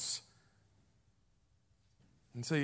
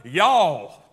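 "Y'all" said loud in a Southern drawl, falling; 3 semitones lower, 8 dB louder than usual. Shown as a demonstration. Preacher showing the plural you to the church, Southern accent. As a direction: give "Y'all" a hearty Southern twang. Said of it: "It's a good Southern word".

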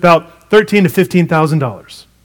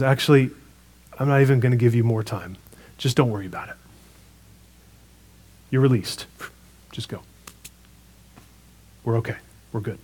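softer, lower, a quiet merciful release; gentle forgiving king; 5 semitones lower, 8 dB quieter than usual. Retold or imitated. King to kneeling servant, soft and gentle pardon. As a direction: let the debt go softly, like a kind king.

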